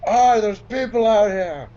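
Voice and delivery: high, loud drawn-out holler; top-of-lungs yell. Acted. Player yells for service at the desk, top-of-lungs yell. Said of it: "I just yell at the top of my lungs".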